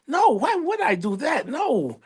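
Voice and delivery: high-pitched, overdone reassurance; mock innocent denial. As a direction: deny it as if the idea were absurd. Acting out his innocent denial.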